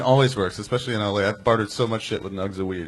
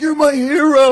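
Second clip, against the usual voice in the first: gushing; 11 dB louder, slower than usual. Retold or imitated. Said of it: "I was like".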